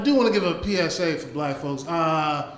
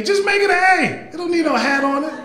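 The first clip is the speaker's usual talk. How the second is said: exasperated shout; 10 semitones higher than usual. Acted. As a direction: yell it like the hat offends you. Ranting about handwriting.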